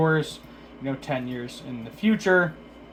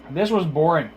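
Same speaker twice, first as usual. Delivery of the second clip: ordinary delivery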